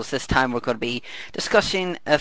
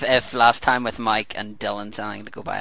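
ordinary talk

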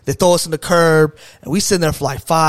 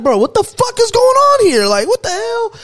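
high-pitched, loud panicked disbelief; retold freak-out; 5 dB louder than usual. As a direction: act out tripping out, voice shooting way up. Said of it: "I'm tripping now".

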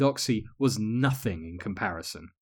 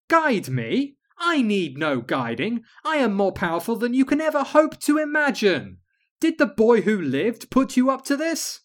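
high, loud imperious witch; haughty scorn; 10 semitones higher, 6 dB louder than usual. Acted. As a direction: sneer down from the throne.

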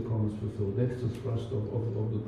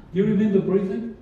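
playful tease; 7 semitones higher, 6 dB louder than usual. Real, not acted. Teasing the congregation.

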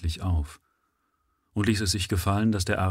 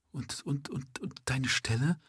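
soft, higher, stuttering and shaky; trembling voice; 6 semitones higher, 8 dB quieter than usual. A narrator's reading. Frightened mother asking about his job, trembling. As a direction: let the voice tremble and falter. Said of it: "sagte sie mit zitternder Stimme".

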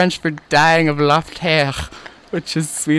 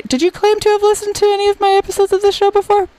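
mock accusing. Host mock-interrogating his guest, high and teasing. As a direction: grill her in a squeaky put-on voice.

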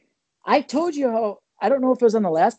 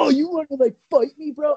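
mocking tough guy; 8 semitones higher than usual. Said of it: "I started mocking the kid".

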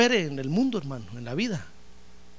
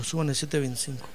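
"súbanle" said again lower and softer; softer, lower echo of the boss; quiet repeat; 4 semitones lower, 7 dB quieter, faster than usual. Retold or imitated. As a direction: repeat the order under the breath.

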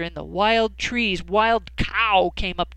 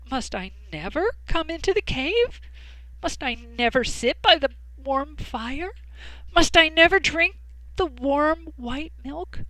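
mock sorry cat; 7 semitones higher than usual. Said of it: "the cat pretended to be sorry".

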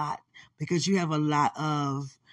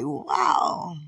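awed wow; 11 semitones higher than usual. Retold or imitated. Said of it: "I was like".

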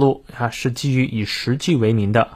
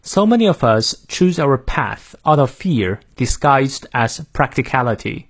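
American accent. Shown as a demonstration. Teacher modelling the sentence for learners, American accent. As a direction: read it in careful American English, flat a in "path". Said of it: "美式发音，我来念两遍".

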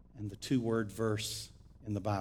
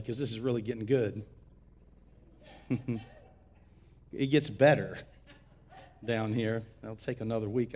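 ordinary delivery

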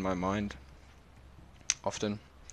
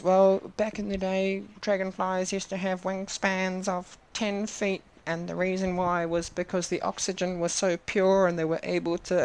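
put-on kid voice; 9 semitones higher than usual. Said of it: "the Kid goes".

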